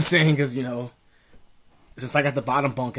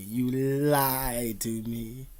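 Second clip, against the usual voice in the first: very slow, stretched, rising at the end; slow drawn-out accusation; much slower than usual. Retold or imitated. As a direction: drag every word out in reproach. Repeats the accusation twice.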